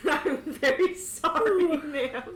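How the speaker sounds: stifling real laughter as the polite guard; laughing through lines. Real, not acted. GM voicing guard to the furious noble, laughing. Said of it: "I'm gonna cry".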